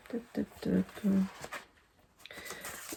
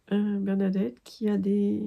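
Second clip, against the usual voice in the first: ordinary delivery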